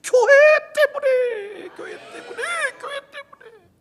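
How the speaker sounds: high cracking cry, fading to a whisper; voice breaking. Real, not acted. Pastor to congregation, tearful voice breaking. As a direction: break down on the words.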